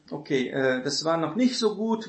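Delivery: normal talking voice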